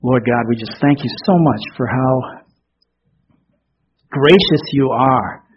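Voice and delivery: slow, soft prayer voice; closing prayer. Acted. Pastor leading the congregation in prayer, slow and soft. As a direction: pray it gently.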